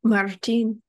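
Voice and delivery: Martin with a rolled R, Puerto Rican style; Spanish-sounding Martin, "mar-TEEN". Retold or imitated. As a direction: roll the R in Martin and lean on the last syllable. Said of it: "She has this beautiful roll".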